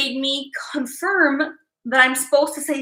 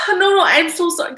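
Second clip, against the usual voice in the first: real sob breaking through an apology; crying; 3 semitones higher, 4 dB louder, faster than usual. Real, not acted. Apologizing to viewers as she breaks down crying. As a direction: apologize while the tears spill over.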